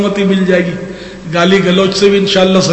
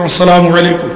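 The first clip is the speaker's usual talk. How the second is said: loud greeting. Shown as a demonstration. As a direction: call out السلام علیکم clearly and a bit louder. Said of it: "زور سے کہتا ہوں". (زور سے سلام)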